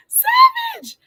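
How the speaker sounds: high, loud shout of savage. Real, not acted.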